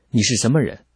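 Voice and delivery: understated angry challenge; fierce roar. A narrator's reading. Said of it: "他更加凶狠地吼道".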